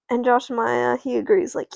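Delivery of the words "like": usual speaking voice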